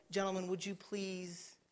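soft, mock-courteous asking; mock polite request. Preacher showing what Jesus didn't say, softly polite. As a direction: ask meekly, well under your preaching voice. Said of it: "he didn't say".

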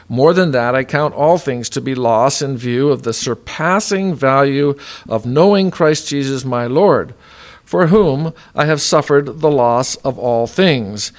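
loud, emphatic Bible reading; scripture reading. Acted. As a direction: proclaim the verses with force. Reads Scripture aloud with force.

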